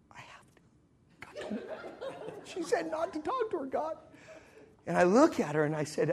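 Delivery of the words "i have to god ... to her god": hushed protest, rising high and thin; whispered pleading. Retold or imitated. Traveler privately pleading with God, whispered. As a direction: plead under your breath so she cannot hear.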